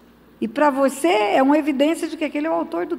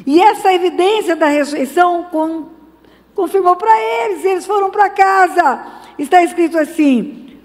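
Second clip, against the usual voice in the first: loud, high preaching burst; rising preaching; 5 semitones higher, 5 dB louder than usual. Real, not acted. Preacher crying out to the congregation, loud and high. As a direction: lift the church with it.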